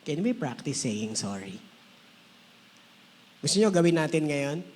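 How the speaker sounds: ordinary talk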